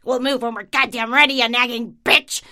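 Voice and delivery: loud, gruff imagined stranger; imagined rude retort. Retold or imitated. Imagining the shopper snapping back at her, loud and rude. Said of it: "They'd probably say something like".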